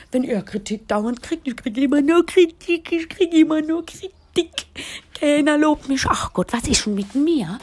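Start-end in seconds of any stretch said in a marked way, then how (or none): 1.5-7.6 higher, louder whiny complaint; higher-pitched whiny moan; mock whining partner. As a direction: whine like a sulking man.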